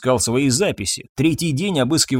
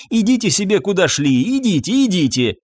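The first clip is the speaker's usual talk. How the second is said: shooing away; 6 semitones higher than usual. A narrator's reading. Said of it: "замахал на него Фабьен".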